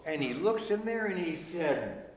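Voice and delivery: usual speaking voice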